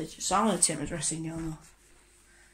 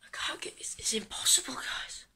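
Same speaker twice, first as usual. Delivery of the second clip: whispering; 3 semitones higher, 10 dB quieter than usual. Real, not acted. Struggling, whispered aside.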